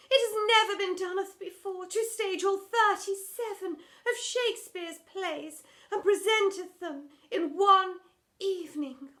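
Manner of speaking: high, loud, tearful wobble; wobbly tearful plea. Acted. Drama teacher begging headmistress, high and tearful. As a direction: plead on the verge of tears, hammed up. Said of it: "her voice began to wobble with emotion".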